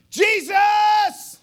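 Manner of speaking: full, high, drawn-out shout; crying out. Acted. Blind beggar jumps up to be heard, crying out. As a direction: holler the name as loud as you can. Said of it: "he cries out".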